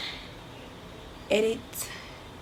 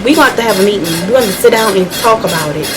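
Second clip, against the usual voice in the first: mock rant. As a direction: rant at Kim like a wronged fan. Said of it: "my little rant about Skims".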